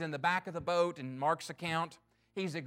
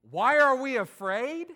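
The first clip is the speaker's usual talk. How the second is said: loud, higher mock-incredulous question; a sarcastic comeback to Jesus, louder; sarcastic retort; 5 semitones higher, 8 dB louder than usual. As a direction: throw the question back with scorn. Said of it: "we might be pretty sarcastic".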